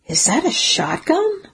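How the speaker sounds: sharp rising disbelief; startled question. A narrator's reading. Narrator spotting the shotgun, startled and disbelieving. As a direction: catch sight of the gun and gasp the question.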